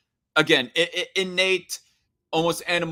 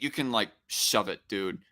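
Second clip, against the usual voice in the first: usual speaking voice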